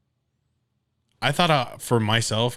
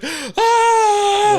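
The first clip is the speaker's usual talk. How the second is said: much higher, louder shared gasp of delight; crowd gasp; 12 dB louder than usual. Retold or imitated. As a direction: gasp like a whole store hearing big news. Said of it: "And everyone's like".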